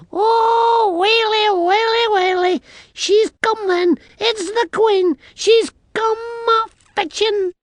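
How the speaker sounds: very high, loud little-man wail; panicked wailing. A narrator's reading. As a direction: wail in dread as a tiny Scot. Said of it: "wails and groans".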